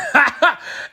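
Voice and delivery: high-pitched excited laugh; high giggle. Real, not acted. Fan laughing at his own hype, high giggle.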